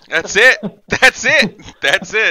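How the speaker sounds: loud, high, through laughter; real laughing delivery, pitched high. Real, not acted. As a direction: crack up while agreeing. Cracking up at a friend's joke.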